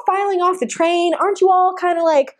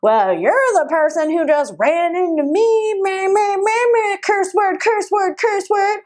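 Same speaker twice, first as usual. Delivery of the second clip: mocking the rude woman; 6 semitones higher than usual. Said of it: "she responded with".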